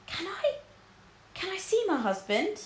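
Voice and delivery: high, tearful plea from a grieving wife; weeping. Acted. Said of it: "wept Missus Beamish".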